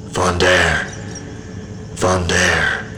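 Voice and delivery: low echoing voice. A narrator's reading. Unseen voice calling Vondair from the ether, a soft distant echo. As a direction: call the name from somewhere beyond, hollow and far. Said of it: "A low, echoing voice called to me".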